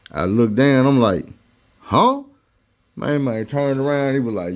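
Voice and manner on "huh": much higher startled huh; surprised huh. Retold or imitated. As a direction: caught off guard, play dumb. Guard asks for the bracelet.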